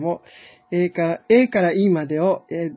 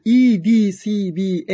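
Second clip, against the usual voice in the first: dictating; 6 dB louder than usual. Shown as a demonstration. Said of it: "今度は逆に打ちます".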